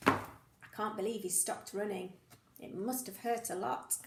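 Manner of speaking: usual speaking voice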